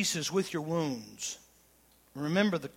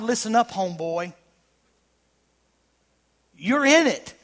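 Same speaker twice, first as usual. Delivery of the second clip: loud, high preacher voice; full-force preaching; 6 semitones higher, 10 dB louder than usual. Acted. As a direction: cry it out to the room. Fiery preaching peak.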